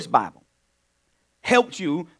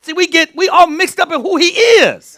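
preaching at full force, higher and louder; 8 semitones higher, 10 dB louder than usual. Real, not acted. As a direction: build it up like a sermon, higher and louder. Preaching builds up.

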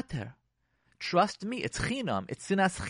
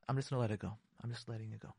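low, very soft, settling; calm release; 5 semitones lower, 12 dB quieter, much faster than usual. Acted. Husband deciding to let go, quietly calm. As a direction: let it drop away almost inaudibly.

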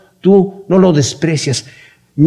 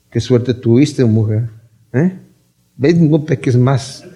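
low, slow and grudging; dismissive mock reading; 6 semitones lower, 3 dB quieter than usual. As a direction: act out a cold send-off, low-pitched. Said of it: "no, no le dijo así". (tono seco y desdeñoso, en voz baja y grave)

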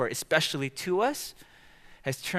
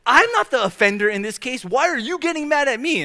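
much higher, louder outraged self-defence; indignant protest; 12 semitones higher, 6 dB louder than usual. Retold or imitated. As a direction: whine like the wronged party. Defending himself to wife.

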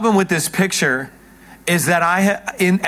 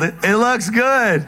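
mild mock yell; 4 semitones higher, much slower than usual. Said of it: "[name] just yelled".

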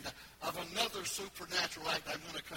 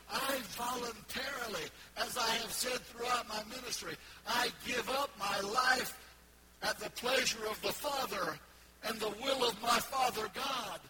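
loud, high, shouted proclamation; full-force preaching; 6 semitones higher, 8 dB louder than usual. Real, not acted. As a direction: preach with all your voice. Preacher voicing Jesus, full force.

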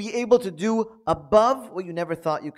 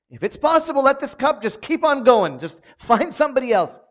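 pleading Jesus; 8 semitones higher, 3 dB louder than usual. Retold or imitated.

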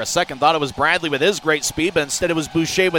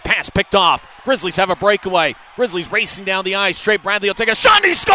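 rising to a shouted goal call; excited goal call; 5 semitones higher, 5 dB louder than usual. Real, not acted. Goal call building to score.

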